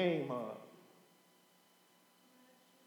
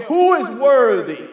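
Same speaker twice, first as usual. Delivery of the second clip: slow, much louder proclamation; loud angel's call; 6 semitones higher, 10 dB louder than usual. Acted. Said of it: "a loud angel came and said".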